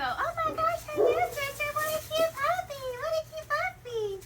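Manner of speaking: over-the-top excited puppy talk; overexcited puppy greeting. Shown as a demonstration. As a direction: gush over the puppy way too much. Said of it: "But if you go".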